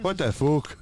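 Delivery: high-pitched mock Irish exclamation; indignant put-on Irish brogue; Irish accent. Shown as a demonstration. Performing Irish for co-host, indignant mock accent. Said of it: "Do Irish".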